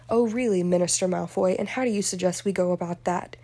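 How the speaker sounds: usual speaking voice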